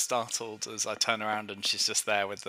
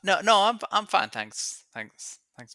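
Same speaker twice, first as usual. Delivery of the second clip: flustered polite refusal; 10 semitones higher, 4 dB louder than usual. Acted. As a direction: decline hastily, then trail away. Startled guest declines a second drink.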